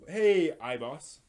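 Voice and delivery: stretched-out loud hello to a viewer; loud greeting. Real, not acted. Streamer calling out to a viewer who just joined, loud and drawn out. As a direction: call out a greeting, slow and loud.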